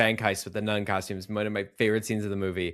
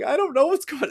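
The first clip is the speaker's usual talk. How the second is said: very high-pitched, bewildered plea; mock a clueless man being grilled; confused victim; 4 dB louder than usual. Retelling the guy's answer under interrogation, mock confused. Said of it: "He's so confused in the ground".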